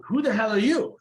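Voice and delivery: high, loud challenge; high elf demand. Acted. Elf repeating his demand to the barbarian, high and loud.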